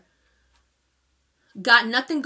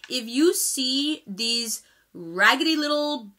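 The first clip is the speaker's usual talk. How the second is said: slow, halting restraint with pauses; controlled anger; slower than usual. Real, not acted. Asking viewers to flag trolls, controlled anger. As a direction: hold the anger back word by word. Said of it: "you see how much I'm controlling myself".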